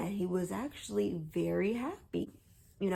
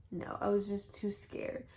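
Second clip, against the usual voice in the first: usual speaking voice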